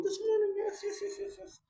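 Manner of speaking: very high put-on squeak; high squeaky voice. Vlogger correcting himself about this morning, high squeaky voice. Mock redo of 'this morning'.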